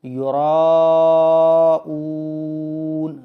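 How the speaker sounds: "یراؤون" with a very long held raa; drawn-out recitation. Shown as a demonstration. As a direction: stretch the raa in "یراؤون" far beyond normal. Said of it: "را کو زیادہ کھینچنا ہے".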